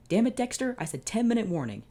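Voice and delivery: high, loud exasperated yell. Acted. Larry yelling at Dexter chasing the toy truck, exasperated. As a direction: shout after the toy. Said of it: "yelled Larry Daly".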